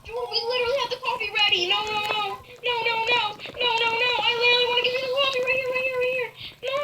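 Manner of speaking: high, fast frantic pleading. Real, not acted. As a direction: rush it out in a panic. Frantic about coffee timing.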